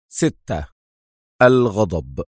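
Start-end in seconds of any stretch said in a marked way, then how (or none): none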